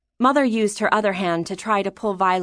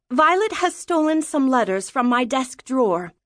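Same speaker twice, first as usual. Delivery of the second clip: lightly voiced higher-pitched mother; tattling mother; 5 semitones higher than usual. Mother reporting daughter to father, a touch indignant.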